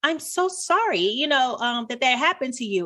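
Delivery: high-pitched imitation of concerned son; son consoling. Son consoles mum who is sad today, imitated high voice. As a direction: mimic a child's sympathy, starting high and easing down. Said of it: "He's like".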